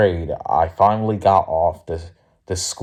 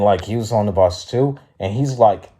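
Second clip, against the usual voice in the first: usual speaking voice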